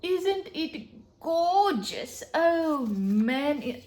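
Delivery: usual speaking voice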